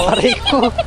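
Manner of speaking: sharp high yelp of pain; pained cry. Real, not acted. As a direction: yelp as if hurt. Fell in the dark, pain. (napasigaw sa sakit)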